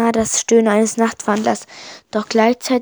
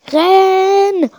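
high, loud urgent shout; frantic order to run; 9 semitones higher, 7 dB louder than usual. A narrator's reading. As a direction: bellow it at your friend. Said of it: "ruft Primo".

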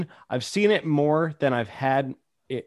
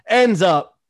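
ordinary talk, nothing marked

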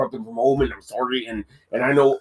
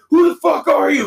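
high, louder mock shout; shouting robber; 10 semitones higher, 4 dB louder than usual. Robbers dressed as piggies shout at Wolf Cop, mock shout. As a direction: bark the question at him. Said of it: "they're like".

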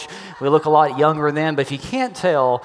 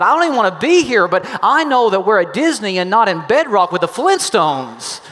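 loud, fast, higher complaint; irritated retort; 4 semitones higher, 5 dB louder than usual. Retold or imitated. Boyfriend scolding his girlfriend at the show, irritated. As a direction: snap the complaint at her. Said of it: "I told her that".

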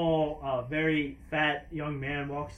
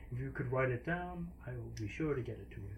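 lightly voiced clerk; 4 semitones lower, 10 dB quieter than usual. Genasi clerk asks for writing.

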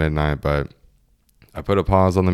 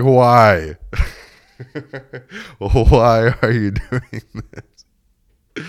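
loud, high, drawn-out wail of disbelief; mock despairing plea; 6 semitones higher, 5 dB louder, much slower than usual. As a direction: imitate a host wailing over his wrecked wall. Smaller dude despairs at bigger hole.

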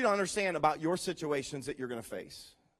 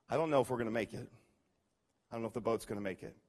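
fast, much softer worried rush; anxious murmur; 10 semitones lower, 7 dB quieter, much faster than usual. Acted. An anxious mind doubts the boat will make it, in a fast murmur. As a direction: race through it under your breath. Voicing anxious inner thoughts.

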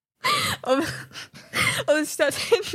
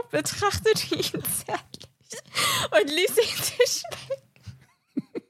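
breaking into helpless laughter, slow and high; laughing while reading; 9 semitones higher, much slower than usual. Real, not acted. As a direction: fight the giggles to get the words out. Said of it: "Kommen Sie klar, soll ich weitermachen?".

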